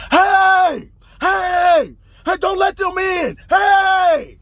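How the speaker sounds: high, full-voiced mock shouts; screaming and yelling. Roommate yelling to keep police out, screaming and yelling. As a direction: yell it high and drawn out, as if overheard. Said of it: "I'm hearing this screaming and yelling".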